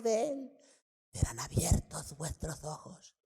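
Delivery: sly serpent voice, whispering then high; snake voice. Acted. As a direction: tempt her like a hissing snake. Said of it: "voy a hacer mi voz de serpiente".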